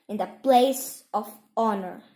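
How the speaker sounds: ordinary delivery, nothing marked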